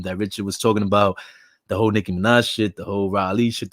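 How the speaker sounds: usual speaking voice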